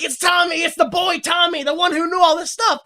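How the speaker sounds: imitating a frantic informer; mock desperate accusation. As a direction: blurt it out like a cornered man. Acts out man accusing boy.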